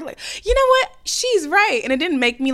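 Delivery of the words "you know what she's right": high, loud, fired-up realisation; mock eureka moment; sudden inspired spark. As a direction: burst out like it just clicked. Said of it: "it wasn't like this spark".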